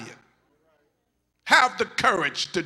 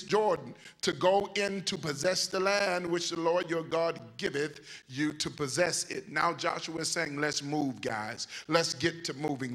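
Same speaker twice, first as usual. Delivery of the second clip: low, calm scripture reading; 4 semitones lower than usual. Acted. Preacher reading Joshua to church, low and calm. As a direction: read the verses steady and grounded.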